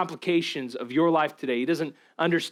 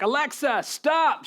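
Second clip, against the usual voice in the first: high, loud frantic yell; wife yelling at the device to stop the wrong song; 12 semitones higher, 3 dB louder, slower than usual. Retold or imitated. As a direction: shout the stop command as the song plays. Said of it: "She's yelling at Alexa".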